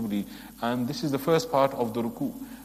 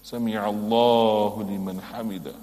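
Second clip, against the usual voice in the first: proper recitation; 2 semitones lower than usual. Shown as a demonstration. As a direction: say every word with care.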